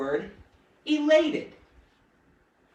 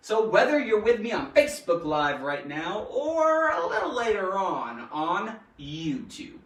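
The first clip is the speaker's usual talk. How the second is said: ordinary delivery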